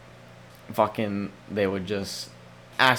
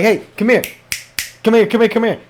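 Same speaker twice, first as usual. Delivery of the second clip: put-on louder, higher call to a child; 7 semitones higher, 6 dB louder, faster than usual. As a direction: call the kid over insistently. Calling a kid over.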